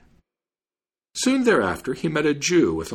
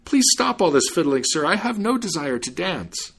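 high-pitched, agitated protest; exclaiming; 7 semitones higher than usual. A narrator's reading. Man forced to dance begs fiddler to stop, exclaiming. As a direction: plead in protest, pitch raised.

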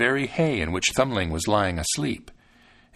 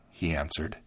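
ordinary delivery, nothing marked

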